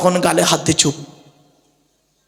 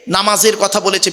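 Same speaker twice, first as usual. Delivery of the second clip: ordinary talk, nothing marked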